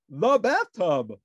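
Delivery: loud, slow triumphant guess; detective proudly wrong; Sherlock crying out. A narrator's reading. Detective blurting a guess at Ernie, loud and slow. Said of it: "cried Sherlock".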